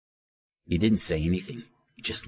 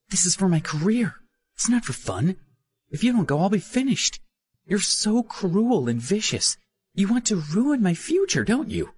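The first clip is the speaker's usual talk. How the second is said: high, loud, vicious accusation; high-pitched angry young woman; Minnie furious; 14 semitones higher, 3 dB louder than usual. A narrator's reading. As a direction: lash out at him, desperate. Minnie lashes out.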